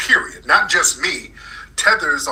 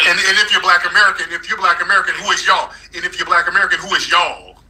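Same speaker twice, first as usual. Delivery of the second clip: raised pitch, pressing the question; heated challenge; 6 semitones higher, 3 dB louder than usual. Real, not acted. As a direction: challenge the caller insistently, higher than usual.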